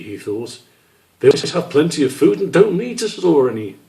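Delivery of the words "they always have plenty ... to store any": a touch higher, musing aloud; curious little squirrel thinking; lightly voiced young squirrel. Squish wondering about other animals, lightly higher.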